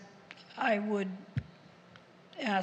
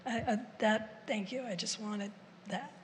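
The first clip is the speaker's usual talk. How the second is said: ordinary delivery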